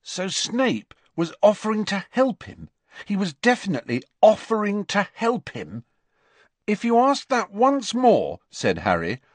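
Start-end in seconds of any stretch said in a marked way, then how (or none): 0.0-5.8 high-pitched, doubting recap of the news; incredulous checking. A narrator's reading. Ron double-checking Harry's news, incredulous. 6.7-8.4 high, louder, fed-up threat; exasperated warning. A narrator's reading. Harry fed up with Ron's repeated question, exasperated.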